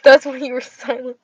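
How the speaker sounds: ordinary delivery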